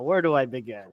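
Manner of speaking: ordinary talk, nothing marked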